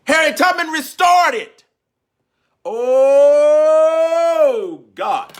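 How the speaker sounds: high, full-force preacher's cry; impassioned shout. Real, not acted. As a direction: shout it out, then hold a long high cry. Preacher climax, crying out her point.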